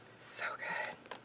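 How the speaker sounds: whispered so good; hushed thrilled whisper; excited whisper. Real, not acted. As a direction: breathe it in delight. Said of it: "I'm getting a little too excited".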